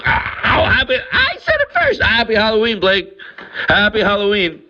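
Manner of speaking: high, giddy Halloween greeting; excited squeal. Real, not acted. Racing to say it first.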